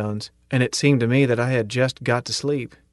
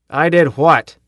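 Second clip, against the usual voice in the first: loud, higher rebuke; sharp demand; 4 semitones higher, 6 dB louder, slower than usual. A narrator's reading. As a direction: snap back at the recruit. Sergeant demands "sir.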